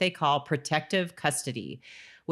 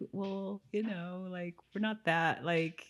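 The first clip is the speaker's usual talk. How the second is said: mock awkward apology; 4 semitones higher, 4 dB quieter than usual. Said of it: "this look on her".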